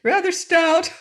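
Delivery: high, loud laughing exclamation; merry laughter. A narrator's reading. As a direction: laugh till the tears come. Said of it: "wipe the tears of merriment".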